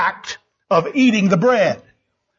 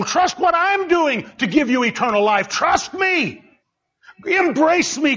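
Jesus pleading; 7 semitones higher than usual. Acted. Jesus speaking through the preacher.

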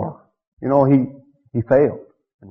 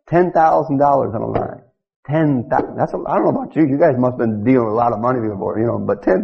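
ordinary talk, nothing marked

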